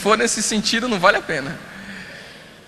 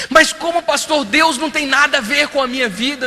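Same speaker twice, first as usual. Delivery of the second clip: louder, higher protest; indignant protest; 7 semitones higher, 7 dB louder than usual. Retold or imitated. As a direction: push back in disbelief. Girl protests to pastor, retold. (protesto indignado)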